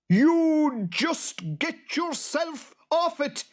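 very high, slow, loud creature screech; boggart yell. A narrator's reading. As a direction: yell it drawn out at the farmer. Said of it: "yelled the boggart".

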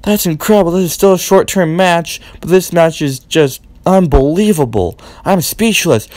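ordinary delivery

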